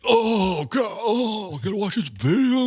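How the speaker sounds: high, drawn-out mocking groan; exaggerated whining of put-upon kids; whiny complaint. Shop owner mimicking kids told to rewatch safety video, high whiny complaint. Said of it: "the reaction that they gave".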